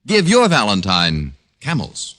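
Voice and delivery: ordinary talk, nothing marked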